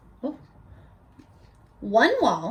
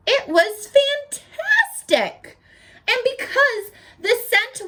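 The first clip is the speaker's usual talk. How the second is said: high slow gushing; delighted; 10 semitones higher than usual. Acted. Reader voicing the pig's delight at flowers, gushing. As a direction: savour the word, sound thrilled. Pig loves the scent.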